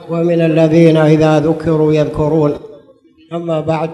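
measured formal opening invocation; formal standard arabic. Acted.

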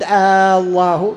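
آه الله with a long rising آه before الله; muezzin's wrong آه prefix; wrong drawn-out آه. Shown as a demonstration. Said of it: "لا يمد".

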